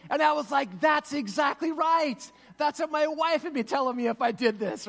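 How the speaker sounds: high, loud, mock-furious scolding; outraged retold quarrel. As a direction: act out an indignant spouse at full volume. Acted retelling of a couple's quarrel.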